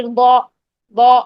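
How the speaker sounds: با as a long, heavy Arabic ضا, rounded toward "aw"; heavy ضاد with fatha. Shown as a demonstration. Teacher models for students, long heavy ضا. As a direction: hold با full, pushed back toward the throat. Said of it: "اس طریقے سے".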